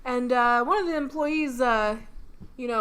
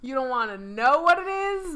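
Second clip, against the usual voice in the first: usual speaking voice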